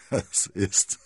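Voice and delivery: breathy words breaking into laughs; bursting into laughter. A narrator's reading. Cardinal is overcome by D'Artagnan's paper, laughing. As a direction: start speaking, give way to laughter. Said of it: "brach ein dröhnendes Lachen aus ihm hervor".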